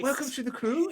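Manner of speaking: in-character welcome. Acted. Game master voices character welcoming newcomer.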